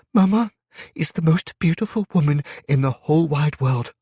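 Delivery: put-on high, loud child's voice, beaming through tears; proud little girl. A narrator's reading. Little girl tearfully praises her mother's beauty. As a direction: adore her mama out loud. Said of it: "smiled through her tears".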